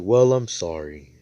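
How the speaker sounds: loud, evenly pitched apology; acted apology. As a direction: keep it firm and only a little drawn out. Same apology said again, more evenly.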